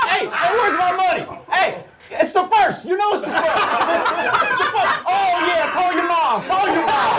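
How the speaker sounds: high squawky turkey voice, laughing; turkey impression. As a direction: play a cranky turkey, way up high. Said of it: "that's my turkey impression".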